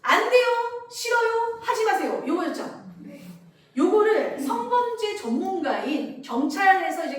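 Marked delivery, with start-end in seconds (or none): 0.0-2.2 put-on loud, high child refusal; a child yelling a practiced refusal; refusal drill. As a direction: shout the old no-go-tell lines. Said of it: "옛날 형교육은".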